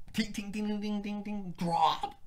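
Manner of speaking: high, tinkly dinging noises; ding quickly up high, then drop; imitated track intro. Imitating a bass song's intro, high dings into a drop.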